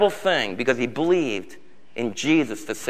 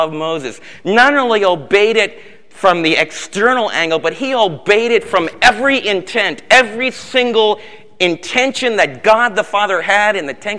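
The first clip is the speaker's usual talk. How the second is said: louder, higher fiery preaching; full-force preaching; 5 semitones higher, 3 dB louder than usual. Acted. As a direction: build the crowd with rising fervor.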